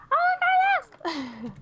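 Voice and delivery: high slow announcer voice; voice pack voice. Retold or imitated. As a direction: stretch it out like a game voice. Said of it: "I was gonna make him say".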